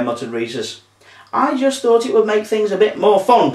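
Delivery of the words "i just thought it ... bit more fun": high-pitched, defensive snap; snapping. A narrator's reading. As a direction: snap back in self-defence. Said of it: "Cleo snapped".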